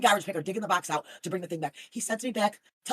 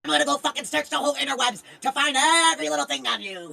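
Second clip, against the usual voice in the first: imitating a furious rant; 6 semitones higher, 6 dB louder than usual. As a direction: rant like an enraged viewer, hammed up. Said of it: "she'll sit there".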